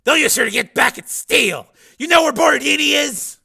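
loud, high barking chief; gruff police chief. Acted. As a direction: bark like a surly boss. Chief shouts.

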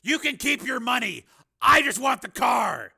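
high, forceful command; loud demand. Acted. Steele demanding the pimp's car, loud and commanding. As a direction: state the demand at full voice, leaning on it.

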